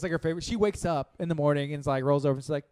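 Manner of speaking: usual speaking voice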